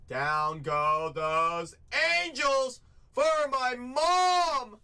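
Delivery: slow, loud, rising announcer call; drawn-out hype call. Real, not acted. Breaker announcing the team sold to his mom, drawn-out call. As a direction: stretch it like a ring announcer.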